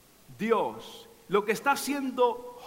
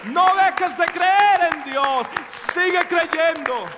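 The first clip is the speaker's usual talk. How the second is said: loud, higher shouted praise; preaching at full force; 6 semitones higher, 5 dB louder than usual. Acted. Preacher crying out to the church, fired-up shouted praise. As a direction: rouse the congregation to bless God.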